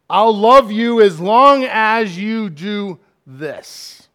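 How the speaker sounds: loud, high, slow insistence; demanding. Acted. Partner making love conditional, loudly demanding. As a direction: spell out the condition, heavily and slowly.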